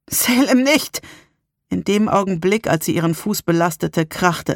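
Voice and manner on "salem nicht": high, choked-out warning; strained warning. A narrator's reading. Narrator warning Salem off the floorboards, strained. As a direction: force the warning out, barely able to speak. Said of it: "Ich konnte kaum sprechen".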